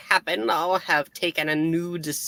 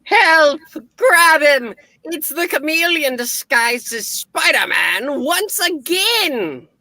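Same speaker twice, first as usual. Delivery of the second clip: fake cry for help; 8 semitones higher than usual. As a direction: shout for help to frame someone else. Villain fakes alarm to frame hero.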